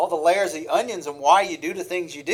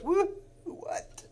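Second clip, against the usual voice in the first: mock panic; 12 semitones higher, 7 dB quieter than usual. Mock panic at bank account.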